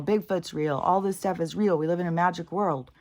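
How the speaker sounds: ordinary talk, nothing marked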